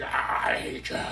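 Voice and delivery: slow, rasping whispered snarl; demonic voice. Retold or imitated. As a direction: hiss it like a possessed person. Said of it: "turn demonic when they get scared".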